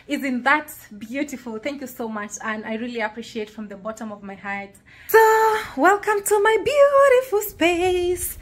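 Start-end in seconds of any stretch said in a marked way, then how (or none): 5.1-8.4 high, loud, slow announcement; showy welcome. Real, not acted. Vlogger opening the room tour for viewers, showy welcome. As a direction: proclaim it grandly, stretching each word.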